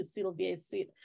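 ordinary talk, nothing marked

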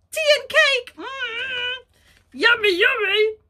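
high, loud yummy cheer; delighted. Acted. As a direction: cheer it greedily. Goose delighted by cake.

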